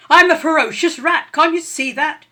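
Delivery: loud, high angry rat shout. A narrator's reading. Rat losing his temper with the sparrow. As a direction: snap it out in rising anger. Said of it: "He was getting angry now".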